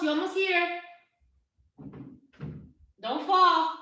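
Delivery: very high loud call. Real, not acted. Calling child to come down.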